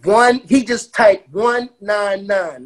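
slow, high, stretched-out digits; drawn-out disbelief. Real, not acted. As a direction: spell out "one nine nine" slowly, each number held. Relishing the surprisingly low price.

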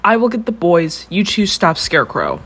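giving orders. A narrator's reading. Heroine directs her team during the rescue, ordering firmly.

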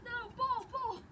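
high panicked calls after the ball; dismayed cry. Real, not acted. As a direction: cry out as the ball gets away. Ball rolling away in snow.

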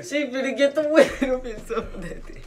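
cracking up while repeating the joke; giggling out the punchline; laughing. Real, not acted.

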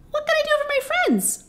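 very high tiny mouse queen; squeaky little voice. A narrator's reading. Queen of the Mice greeting her friends, squeaky. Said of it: "in her squeaky little voice".

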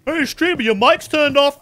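high, loud mock viewer voice; imitating a chat heckler. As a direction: call it out like a pestering viewer. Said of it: "I used to believe that".

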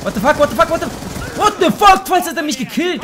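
high-pitched, disbelieving yelp; real panicked gamer freak-out; startled outburst. Real, not acted. Startled at nearly being killed.

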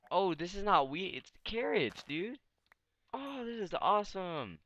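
high, thrilled squeals of delight; excited. Real, not acted. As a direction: gush over the discovery. Finds carrots and cows in village.

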